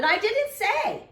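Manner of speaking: put-on high, loud little girl insisting; shouting. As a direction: shout it in defence. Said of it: "she shouted".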